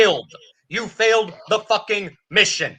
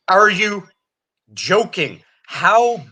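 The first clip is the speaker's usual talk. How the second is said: ordinary talk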